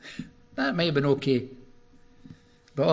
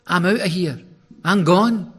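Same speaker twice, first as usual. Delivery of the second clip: louder, higher storm-off; defiant outburst; 7 semitones higher, 10 dB louder than usual. Retold or imitated. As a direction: slam the door on the family. Rebel child storming out.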